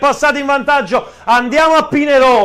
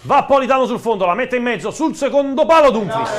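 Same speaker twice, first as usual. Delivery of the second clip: play-by-play commentary; 4 semitones lower than usual. Acted. Live play-by-play of the match.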